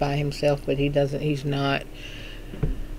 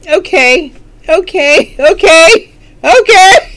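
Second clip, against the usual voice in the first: sassy okays; 11 semitones higher, 13 dB louder, much slower than usual. Pretend. Mock offense continuing.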